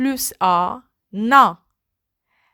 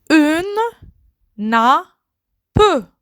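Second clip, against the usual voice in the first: Une, then nappe said as a separate slow syllable; syllabified; 4 semitones higher than usual. Shown as a demonstration. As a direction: split "Une nappe" into two beats. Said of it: "word split into syllables". ("Une nappe" détaché syllabe par syllabe)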